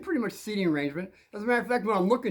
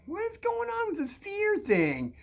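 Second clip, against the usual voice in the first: high, slow, baffled complaint; mock exasperation; 14 semitones higher than usual. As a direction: act out being baffled by it all. Mock exasperation at fear talk.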